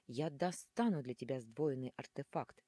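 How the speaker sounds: plain reading voice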